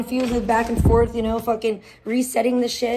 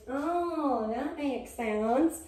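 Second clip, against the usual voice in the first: slow realisation; 2 semitones higher, 8 dB quieter, much slower than usual. Retold or imitated. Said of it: "which I was like".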